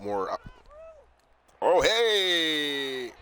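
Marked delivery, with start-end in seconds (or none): 1.6-3.1 high, loud sudden exclamation; surprised. Real, not acted. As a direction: react suddenly to something.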